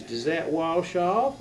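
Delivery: put-on slow Texas drawl; Texas mom accent. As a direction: draw it out like a Texan mom. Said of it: "She was from Texas and never lost her accent".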